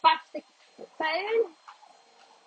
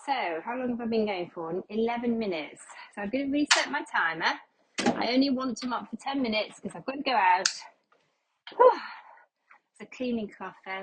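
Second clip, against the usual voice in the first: ordinary talk, nothing marked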